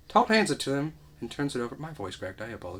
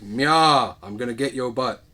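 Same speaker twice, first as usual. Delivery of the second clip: mock killer threat; 9 dB louder, slower than usual. Retelling killer threat, loud.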